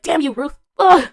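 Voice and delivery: high, loud jealous outburst; exasperated mock curse. Jealous fan cursing the actor's wife, mock fury. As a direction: curse the rival, ending in a loud groan.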